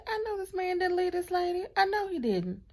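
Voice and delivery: high, heated disbelief, near tears; indignant outburst about the abandoned wife. Retold or imitated. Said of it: "I was instantly heated".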